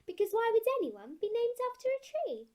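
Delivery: high, swooping incredulous question; amused disbelief. Acted. Girl scoffing at being named after a tree, incredulous. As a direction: go way up in pitch, baffled. Baffled at tree name meaning.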